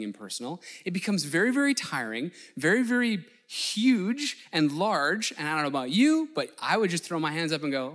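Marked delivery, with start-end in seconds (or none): 2.6-5.3 very, very huge said slowly and louder; stretching words to show how huge; drawn-out emphasis. Real, not acted. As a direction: stretch out the hugeness. Emphasis on size.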